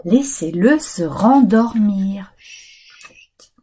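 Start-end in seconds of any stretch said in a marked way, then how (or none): 2.4-3.5 shushing whisper. Acted.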